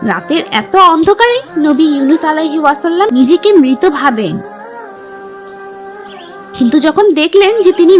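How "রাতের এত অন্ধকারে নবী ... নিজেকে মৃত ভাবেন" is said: ordinary delivery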